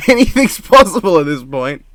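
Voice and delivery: loud, high, laughing exasperation; giggly disbelief. Real, not acted.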